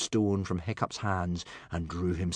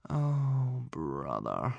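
moaning; 4 semitones higher, much slower than usual. A narrator's reading. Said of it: "moaned Hiccup".

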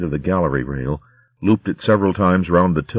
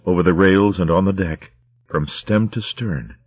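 ordinary delivery

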